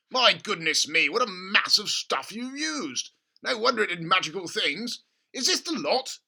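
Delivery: gushing over a money-making idea; excited father. A narrator's reading. Father urging his son at breakfast, excited. Mr Cranky voiced.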